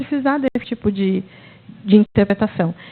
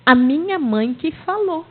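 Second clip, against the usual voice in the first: put-on insistent child; 6 semitones higher, slower than usual. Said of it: "Ele falou assim".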